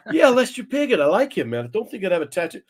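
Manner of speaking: high-pitched mimicry of a clueless friend; Ronnie Edwards. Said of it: "Ronnie spent the next fifteen minutes".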